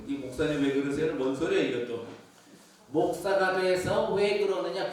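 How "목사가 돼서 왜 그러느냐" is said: loud, slow indignant rebuke; mock rebuke; scolding. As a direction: scold him for acting unlike a pastor.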